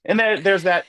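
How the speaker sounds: normal talking voice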